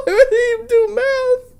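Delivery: high, slow, laugh-cracked question; real laughter. Real, not acted. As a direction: squeak it out while laughing. Laughing at the fanfic.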